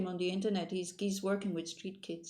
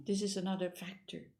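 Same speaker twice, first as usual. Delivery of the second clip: normal talking voice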